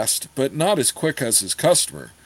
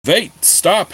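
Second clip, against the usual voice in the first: a call to stop, higher and louder; cried out; 6 semitones higher, 4 dB louder than usual. Acted. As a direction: rush out a plea to stop him. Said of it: "Hengel cried".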